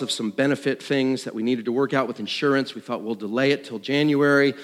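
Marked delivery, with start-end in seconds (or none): none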